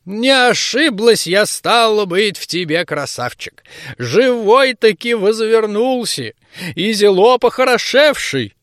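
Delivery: put-on slow, high old granny voice; old woman voice. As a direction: croak it like a village crone. (голос старой бабки)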